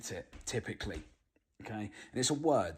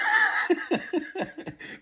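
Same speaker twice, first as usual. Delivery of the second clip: loud, high burst of laughter; 8 dB louder than usual. Real, not acted. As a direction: crack up loudly at yourself. Laughing at own swearing.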